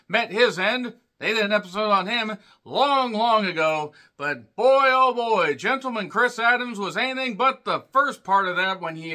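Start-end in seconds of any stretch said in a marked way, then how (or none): none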